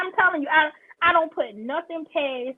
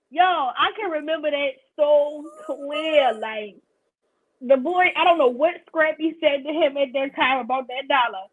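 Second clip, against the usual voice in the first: ordinary delivery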